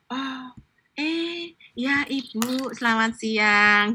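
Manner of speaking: soft, slow polite greeting; very gentle. Retold or imitated. Greeting the reserved Solo woman gently and slowly. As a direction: ease into it softly and slowly. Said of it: "ngomongnya halus banget".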